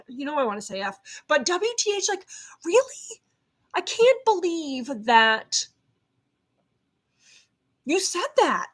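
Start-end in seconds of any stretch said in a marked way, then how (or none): 1.4-8.7 knee-jerk reaction. Retold or imitated. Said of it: "that knee-jerk reaction to be like".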